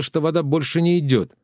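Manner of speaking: ordinary delivery, nothing marked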